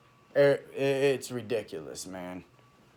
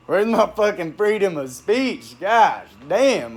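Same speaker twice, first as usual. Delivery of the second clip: loud, raised-pitch outburst; worked up and loud, demanding an answer; getting loud; 6 semitones higher, 7 dB louder, slower than usual. Real, not acted. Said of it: "I ain't getting loud like I am right now".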